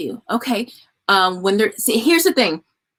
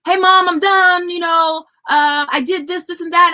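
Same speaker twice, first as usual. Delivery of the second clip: higher, louder mock yell of a boy; imitating son yelling; 6 semitones higher, 3 dB louder than usual. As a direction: play the son reporting across the house. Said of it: "my son would yell".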